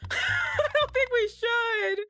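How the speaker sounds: laughing. Acted. Repeats it hammed up.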